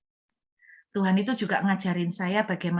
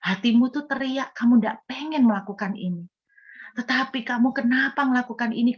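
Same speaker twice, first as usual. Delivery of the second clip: higher-pitched, urgent empathetic plea; impassioned pleading; 4 semitones higher than usual. Retold or imitated. Preacher pleads with a wounded sinner, impassioned and empathetic. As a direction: press on through the wounds, insisting they understand.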